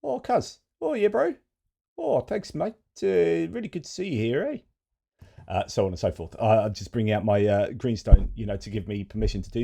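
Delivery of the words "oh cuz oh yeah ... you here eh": very high-pitched Pasifika Kiwi accent; Pacific Islander New Zealand accent. Shown as a demonstration. Presenter greeting a cousin in mock high Pacific Islander New Zealand accent. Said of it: "I'll try and do my Pacific Islander New Zealand accent".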